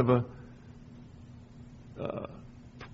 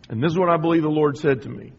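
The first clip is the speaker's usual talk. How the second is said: usual speaking voice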